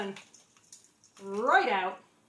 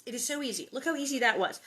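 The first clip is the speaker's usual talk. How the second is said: normal talking voice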